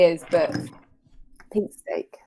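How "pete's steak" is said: breathy whispered punchline. Real, not acted. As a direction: whisper it like a secret aside. Punchline muttered under breath.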